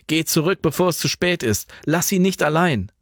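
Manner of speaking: high, pressing warning; urgent warning. A narrator's reading. Franz urging Jan back to Alva. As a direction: urge him back insistently. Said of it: "mahnte Franz eindringlich".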